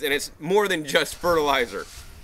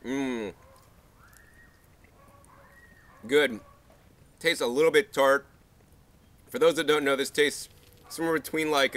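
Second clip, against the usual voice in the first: chewing while talking; tasting fruit mid-bite; mouth full. Real, not acted. Seen 0: bites fruit at -4; lips pursed, jaw working through ~8.8.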